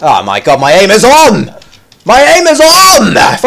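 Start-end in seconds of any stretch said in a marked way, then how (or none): 0.6-1.5 excited boast. Real, not acted. First excited boast about aim. 2.0-3.2 much higher, stretched-out boast; elated yell. Real, not acted. Gamer thrilled with his own aim, elated yell.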